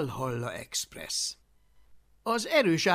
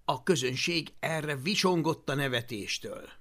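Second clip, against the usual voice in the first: plain reading voice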